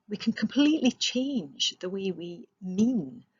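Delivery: ordinary delivery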